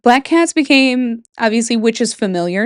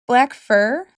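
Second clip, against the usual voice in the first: ordinary delivery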